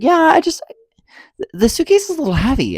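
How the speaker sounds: high-pitched put-on attendant voice; mimicked flight attendant.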